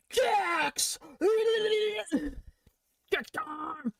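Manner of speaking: high, excited exclamation; hyped-up, high-pitched cheer; excited outburst. Real, not acted. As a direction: burst out with sudden excitement. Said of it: "Sorry, I got excited".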